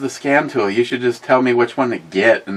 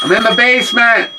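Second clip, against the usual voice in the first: high, loud shout upstairs; from another room; 11 semitones higher, 5 dB louder than usual. Real, not acted. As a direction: yell it up the stairs. Shouting up from the basement.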